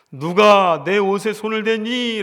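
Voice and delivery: Jesus calling out loud and high, slow; slow, booming question to the crowd. Acted. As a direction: call out over the crowd, demanding an answer. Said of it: "큰 소리로 말씀하시지요".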